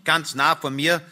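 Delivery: ordinary delivery, nothing marked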